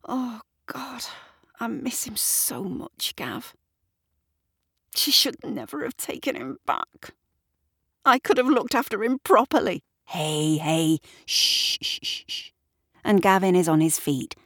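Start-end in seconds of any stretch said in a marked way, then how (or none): 0.1-9.8 softly distressed, higher-pitched; high, pained voice, rising as regret takes over; upset, grieving. A narrator's reading. Shirley tells Gavin she misses him, upset. 10.1-12.5 soothing hush. A narrator's reading. Gavin comforts Shirley as he holds her, soothing.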